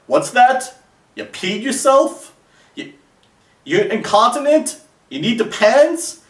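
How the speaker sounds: high and loud, broadcasting her embarrassment; loud mocking yell. Loudly repeating what she said so the whole street hears. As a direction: shout it so everyone hears, slowly and mocking. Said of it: "just as loud as you possibly can".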